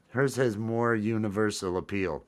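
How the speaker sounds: ordinary delivery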